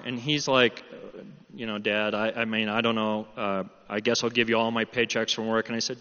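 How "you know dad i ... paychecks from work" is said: put-on low, hesitant teenage offer; sheepish son. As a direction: stumble reluctantly through the offer. Guilty son offering to repay dad.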